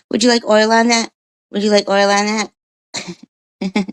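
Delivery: high, fast eager seller's question; pushy vendor offering extras; mock vendor. Mock vendor eagerly offering oil to a customer.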